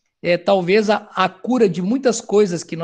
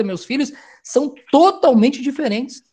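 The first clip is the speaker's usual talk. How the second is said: ordinary talk, nothing marked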